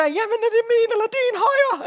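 very high, loud, confused yell; hammed-up panicky call back; shouting back. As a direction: holler a puzzled question across the gap. Acting confused person calling back across.

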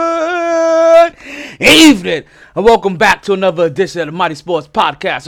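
loud, high, pumped-up greeting; hype podcast intro. Real, not acted. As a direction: open the show like a hype man. Host opens podcast loudly.

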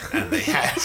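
high shouting laugh. Real, not acted. Recalling the plane costume, loud high laugh. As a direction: burst out laughing mid-sentence.

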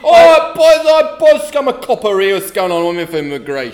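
loud, high police officer bellowing; a copper barging in, shouting at the boys. Pretend. Acting a policeman.

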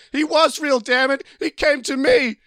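very high, hammed-up outraged scream. Elliot insists to his wife, high acted scream. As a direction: shriek it in mock desperation. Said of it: "Elliot screams".

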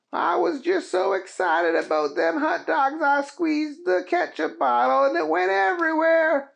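high sheepish explaining; embarrassed cowboy excuse; Keith voice. Acted.

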